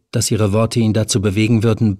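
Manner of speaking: ordinary delivery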